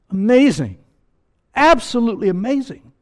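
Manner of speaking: high, loud cry of wonder; awed exclamation. Acted. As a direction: proclaim it as if overwhelmed.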